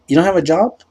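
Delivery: high, loud mock-appalled question; appalled date impression; incredulous imitation.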